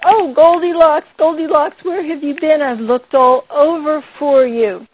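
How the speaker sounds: high, loud relieved cry; Mama finds her lost child and calls out loud; worried mother calling. Acted. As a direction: call out like a frantic, relieved mother. Worried mother voiced in story.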